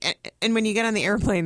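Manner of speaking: ordinary talk, nothing marked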